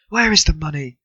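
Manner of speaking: high, loud demanding cry; wife cries out for the missing money. A narrator's reading. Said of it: "she cried".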